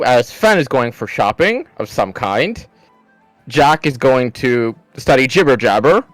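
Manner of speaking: ordinary talk, nothing marked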